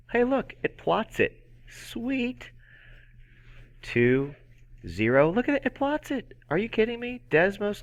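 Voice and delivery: high-pitched, squealing-with-wonder excitement; mock amazement. Amazed that app plots points.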